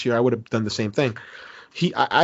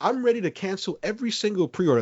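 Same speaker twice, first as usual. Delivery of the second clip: mock threat; 7 semitones higher, 4 dB quieter than usual. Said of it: "That's a lie".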